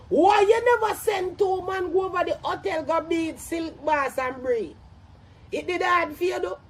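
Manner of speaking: ordinary talk, nothing marked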